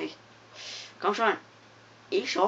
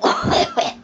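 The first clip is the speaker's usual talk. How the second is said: very high, loud burst of giggling; a sudden shriek of laughter; squealing laugh; 8 dB louder than usual. Real, not acted. Gamer bursts out squealing at the screen. Funny game moment.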